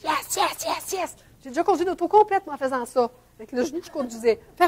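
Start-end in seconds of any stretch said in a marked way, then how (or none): 0.0-1.2 hushed victory cheer. Retold or imitated. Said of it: "puis ça a été accepté?".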